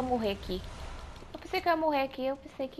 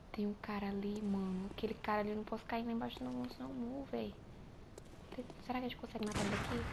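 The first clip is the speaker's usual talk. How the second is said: hushed, breathy sneaking whisper; 3 semitones lower, 15 dB quieter, faster than usual. Real, not acted. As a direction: whisper so they don't hear. Sneaking past enemies.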